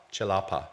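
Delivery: celapa opening on "ch", as in chew; "ch" pronunciation. Shown as a demonstration. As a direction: say celapa as "chelapa". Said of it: "always pronounced as a ch".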